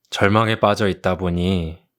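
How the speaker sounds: usual speaking voice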